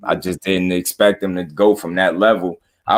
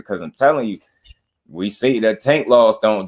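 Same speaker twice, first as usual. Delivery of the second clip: usual speaking voice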